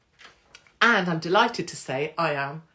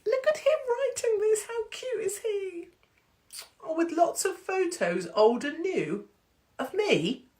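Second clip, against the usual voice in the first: put-on much higher, cooing doting voice, as if at a baby; cutesy cooing. Comedian teasing Mark as he writes, high doting coo. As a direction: coo over him like a cute puppy.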